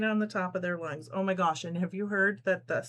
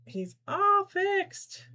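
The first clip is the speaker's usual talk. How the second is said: put-on higher, slow baby talk; babying the toy; 13 semitones higher, much slower than usual. Host cooing to the repaired toy shark, baby talk. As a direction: coo to the shark like a child.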